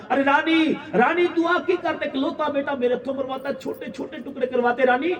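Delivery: high, pleading lament of a grieving king; weeping king. A narrator's reading. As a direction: cry out to the queen over the lost son. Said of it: "रोन लग गया राजा". (रोते हुए राजा का विलाप)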